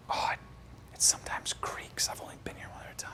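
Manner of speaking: hushed, secretive explanation; whispered excuse. Retold or imitated. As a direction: whisper it while sneaking in. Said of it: "I'm still, like, whispering it".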